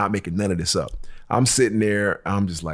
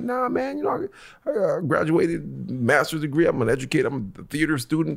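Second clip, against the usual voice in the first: high voiced protest of innocence; mock innocent high voice; high innocent pleading; 8 semitones higher than usual. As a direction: play it up, pitch way up and sound harmless. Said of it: "I'm like".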